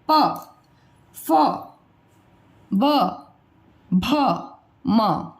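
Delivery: प, फ, ब, भ, म होंठ मिलाकर; हर वर्ण अलग, होंठ हिलाते हुए; labial letters demo. Shown as a demonstration. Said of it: "प वर्ग की इन अक्षरों का उच्चारण". (ओष्ठ्य वर्ण साफ़ बोलना)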